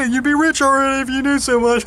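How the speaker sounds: high put-on sneering voice; mocking sneer. Roommate sneering at the speaker, high mocking voice. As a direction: fling the jab back in a silly high voice. Mocked reply in retold quarrel.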